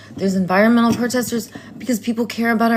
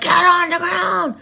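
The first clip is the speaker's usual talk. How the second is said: imitating cop; 9 semitones higher than usual. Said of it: "It was like".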